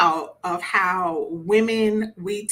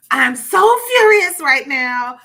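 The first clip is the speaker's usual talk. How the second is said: furious; 4 semitones higher, 9 dB louder, slower than usual. Acted. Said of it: "the man where he's like".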